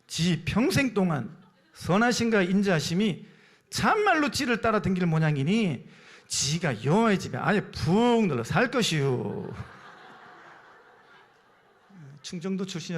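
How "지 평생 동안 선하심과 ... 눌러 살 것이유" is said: Chungcheong dialect, endings stretched out slowly; 충청도 사투리. Shown as a demonstration. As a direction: read the verse as broad Chungcheong dialect. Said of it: "충청도 사투리입니다". (느릿느릿 늘어지는 충청도 사투리)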